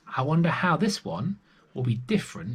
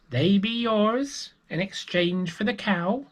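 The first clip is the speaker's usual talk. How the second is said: high, slow creaky beggar bargaining; 10 semitones higher, slower than usual. Acted. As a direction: croak the offer slowly like the old beggar. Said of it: "said the beggar".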